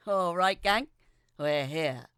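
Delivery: loud, happy dad announcing arrival; father booming that they've arrived; excited exclaim. Acted. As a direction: call out to the family with a grin. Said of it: "my father exclaimed in excitement".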